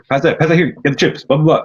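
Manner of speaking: a mock gruff bossy order, high-pitched; mock bossy demand; imitating aggressive tone. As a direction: bark it out like a bossy relative, playfully. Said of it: "this really aggressive kind of tone".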